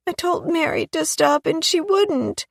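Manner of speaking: put-on high, small child's voice, tearful; little girl Chloe. A narrator's reading. Chloe telling Rose she dislikes swinging high, childlike. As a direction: voice a young girl near tears. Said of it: "said Chloe".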